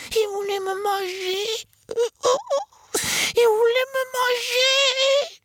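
high, slow wailing chick voice; frightened cry. A narrator's reading. Chick crying to Zorba after the rat fled, high wail. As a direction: cry it out, terrified. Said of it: "cria le poussin".

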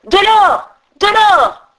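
crié fort et aigu, avide; cri des brigands. A narrator's reading. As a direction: hurlez votre convoitise. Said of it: "s'écrièrent". (brigands qui s'écrient avidement, cri aigu)

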